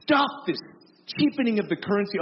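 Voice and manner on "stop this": usual speaking voice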